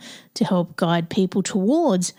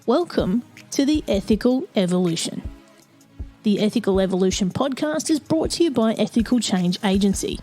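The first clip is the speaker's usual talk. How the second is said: measured announcer read over music; podcast intro read; 3 dB quieter than usual. Acted. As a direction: read it like a polished show opener.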